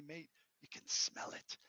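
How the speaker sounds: slow, breathy whisper of wonder; hushed Aussie guy. Retold or imitated. Retelling the Aussie traveller's advice, a slow hushed whisper. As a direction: lean in and whisper the secret slowly. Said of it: "And he's like".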